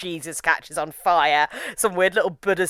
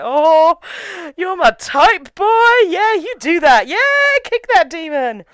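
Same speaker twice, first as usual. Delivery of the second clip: much higher, louder flirty cheer; 11 semitones higher, 5 dB louder, slower than usual. Retold or imitated. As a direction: egg on your crush. Flirting with the character.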